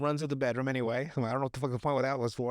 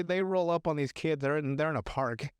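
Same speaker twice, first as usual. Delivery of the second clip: ordinary delivery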